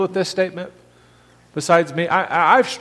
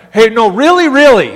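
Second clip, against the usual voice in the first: louder, higher insisting really, really; insistent paraphrase; 4 semitones higher, 8 dB louder than usual. Retold or imitated. Pastor paraphrasing Jesus to congregation, insistent loud emphasis. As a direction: push it like you swear it's true. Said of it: "That's how we would translate that".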